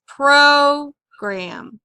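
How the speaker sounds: "Program" with a long, loud PRO; stress on first syllable. Shown as a demonstration. Teacher demonstrating accent to students, "Program" stressed on PRO. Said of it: "usually the first syllable is accented".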